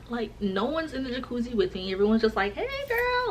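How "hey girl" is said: high-pitched sing-out greeting; high, playful holler without extra volume; friends calling out. Retold or imitated. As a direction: call over to a friend in a high voice. Friends calling over from pool.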